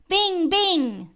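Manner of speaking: high, falling bell-like call; ringing gong imitation. Reciter imitates the passing gong, high ringing call. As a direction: let each stroke ring out high.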